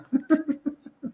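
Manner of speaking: a short giggle; high laugh. Real, not acted. Preacher laughing after mimicking the spy, a high giggle. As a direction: laugh at the joke.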